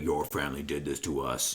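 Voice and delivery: very low, soft mock growl; grim ape. Retelling the friend refusing the caged villain, low and grim. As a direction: drop the voice deep for a solemn accusation.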